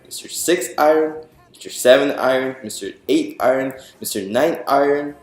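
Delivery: plain reading voice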